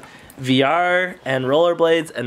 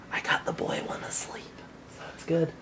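whispering; 14 dB quieter than usual. Real, not acted. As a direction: breathe the words, don't wake him.

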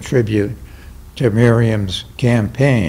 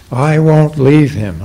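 raised, louder voice in a restrained storyteller's shout; shouted refusal; 5 semitones higher, 5 dB louder than usual. A narrator's reading. Boy refusing to give up his Pinocchio, a contained shout. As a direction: read a child's defiant shout, held back. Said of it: "I shouted".

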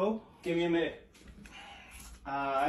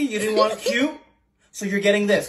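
ordinary talk, nothing marked

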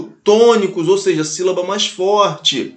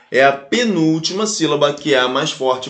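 ordinary delivery

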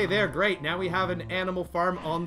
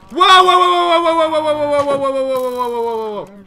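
high whoa shouts fading down; panicked whoa; 10 semitones higher, 17 dB louder than usual. Real, not acted. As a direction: yell in alarm, then calm down. Fighting off mob.

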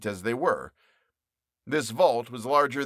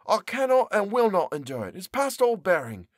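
higher, exasperated character voice; fed-up baker; 5 semitones higher than usual. Acted. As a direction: push the pie away in disgust. Character protests his captivity.

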